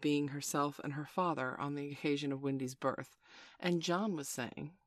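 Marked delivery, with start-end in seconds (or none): none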